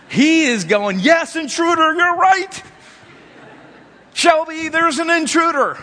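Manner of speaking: excited dog's voice, thinking the owner agrees. Retold or imitated. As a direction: play the dog hearing its owner join the alarm. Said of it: "what the dog is hearing is".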